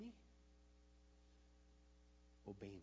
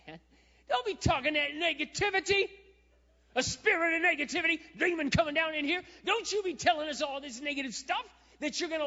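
loud, high-pitched preachy Peter scolding Jesus; hammed-up rebuke of a negative spirit; bold Peter; 15 dB louder than usual. Peter scolding Jesus for talking negative, high and loud. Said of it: "Peter is so bold".

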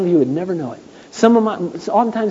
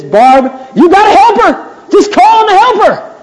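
mock advice; 8 dB louder than usual. Jim's quoted advice.

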